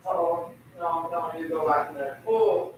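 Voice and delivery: ordinary talk, nothing marked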